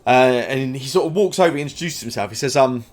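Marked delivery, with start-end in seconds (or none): none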